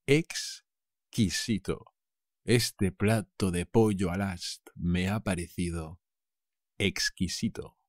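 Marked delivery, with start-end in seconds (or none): 0.1-1.9 slow model word. Shown as a demonstration.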